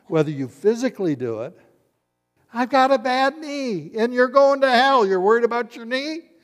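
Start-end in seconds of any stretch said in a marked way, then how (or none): 2.5-6.3 high, very loud hammed-up exchange; full-voiced preacher's parody of protest; mock outraged shouting. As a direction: bellow the excuse and the comeback. Mock excuse and preacher's retort.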